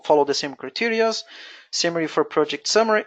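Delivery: ordinary talk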